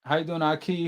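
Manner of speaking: usual speaking voice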